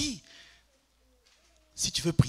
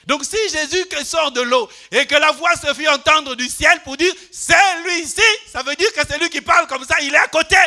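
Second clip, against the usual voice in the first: loud, higher preaching shout; full-force preaching; 10 semitones higher, 12 dB louder than usual. Acted. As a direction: hammer the point home to the crowd. Preacher drives home his point. (prédication à pleine voix)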